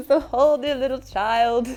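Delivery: high laugh, then slow words through it; teacher cracking up at her own joke. Real, not acted. Laughing at Goat Boy joke.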